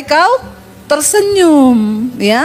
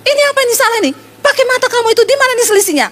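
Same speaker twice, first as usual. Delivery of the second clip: much higher, furious boss yelling; angry rant; 9 semitones higher, much faster than usual. Retold or imitated. Boss raging at the clerk over the wrong numbers, much higher. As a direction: blast the clerk for the error. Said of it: "marah, ngamuk".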